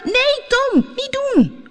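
very high, urgent cry; high-pitched panicked shout of warning; alarmed warning. Acted. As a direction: cry out to stop him in sudden panic. Tina cries a warning too late.

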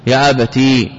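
يا أبتي with a long "ee" at the end; sounded final ya. Shown as a demonstration. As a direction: hold the ي that closes يا أبتي. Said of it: "جاء فيه إثبات الياء".

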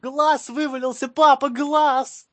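high, slow panicked wail; slow wailing cry. Pretend. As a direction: wail out in mock horror. Son wailing about his eye.

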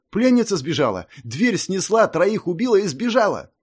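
high, loud panicked report; panicked soldier. Acted. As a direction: blurt it out in alarm.

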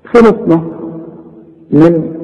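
ordinary delivery

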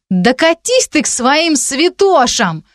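high, drawn-out furious send-off; angry dismissal. A narrator's reading. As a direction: send her packing, stretching the words high. Furious friend stamps her foot. (сердито послать прочь)

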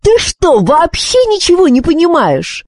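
high-pitched indignant outburst; queen's high, frantic rant; exasperated. A narrator's reading. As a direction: scold a fool in exasperation. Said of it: "простонала королева".

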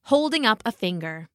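usual speaking voice

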